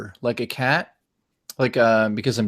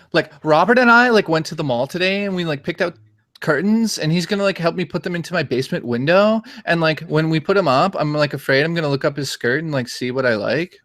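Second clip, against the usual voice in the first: put-on higher-pitched Valley Girl chatter; 3 semitones higher than usual. Shown as a demonstration. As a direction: do a ditzy Valley Girl impression. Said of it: "I could do that too".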